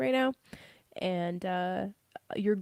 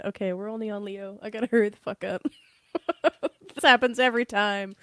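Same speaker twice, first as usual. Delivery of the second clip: usual speaking voice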